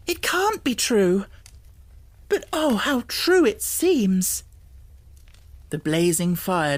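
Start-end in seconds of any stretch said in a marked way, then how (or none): 0.1-4.4 high, louder wondering cry; can't believe it; disbelieving cry. A narrator's reading. Girl crying out in disbelief, high. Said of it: "cried out again".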